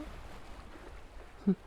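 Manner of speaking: breathy, amused little laugh; soft villain chuckle. Acted. Witch amused by the blushing princess, soft chuckle. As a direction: chuckle softly as if pleased with yourself.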